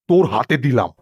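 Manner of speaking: normal talking voice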